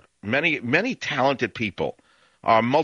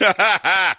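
loud, high burst of laughter; real laugh; 9 semitones higher, 6 dB louder than usual. Real, not acted. Host amused by his own Fox News joke, loud laughing. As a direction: crack up at your own jab.

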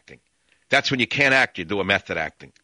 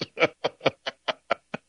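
real laugh; 4 semitones higher than usual. Real, not acted. Host tickled by his chorus line joke, chuckling. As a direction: run of high chuckles.

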